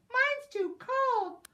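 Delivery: high, slow squeaky whine; high whiny critter. Acted. Contrasting critter complaints.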